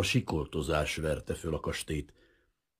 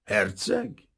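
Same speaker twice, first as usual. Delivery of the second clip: mocking question; 10 semitones higher than usual. A narrator's reading. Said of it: "arca megrándult gúnyosra".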